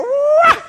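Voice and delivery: very high, loud "wah" shriek; acted wail. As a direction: let out a high, mock demon scream. Acts demons flying out.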